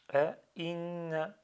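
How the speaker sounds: "A, in" as separate pieces, "in" held; cut into parts. Shown as a demonstration. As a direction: break off after "A, in", holding the n. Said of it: "je coupe sur deux".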